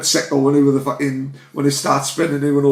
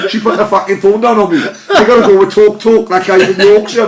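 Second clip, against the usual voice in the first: laughing rant; 5 semitones higher, 4 dB louder than usual. Real, not acted. Laughing while ranting about broadband.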